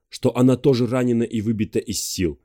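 plain reading voice

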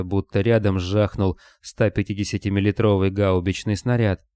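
ordinary delivery, nothing marked

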